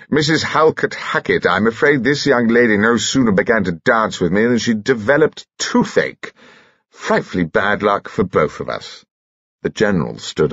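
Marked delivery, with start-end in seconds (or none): 0.1-9.0 affected upper-class gentleman, pitched up then dropping; arch society host. Acted. Host returns girl with toothache to chaperone, arch. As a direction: explain it with arch, insincere regret.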